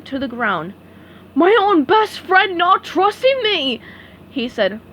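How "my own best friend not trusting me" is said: high, loud, slow wounded outburst; upset. Acted. Patrick upset at SpongeBob, loud wounded outburst. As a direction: wail the betrayal while smashing things. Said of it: "Patrick was so upset".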